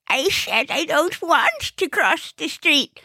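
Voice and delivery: put-on high, wavering elderly woman; cross old lady. Old lady refuses help crossing, high quavering irritated voice.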